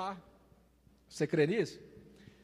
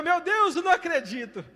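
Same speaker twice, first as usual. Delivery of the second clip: missionary's astonished cry; 9 semitones higher, 3 dB louder than usual. Retold or imitated. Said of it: "Aí a irmã gritou".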